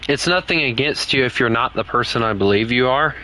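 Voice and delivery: ordinary talk, nothing marked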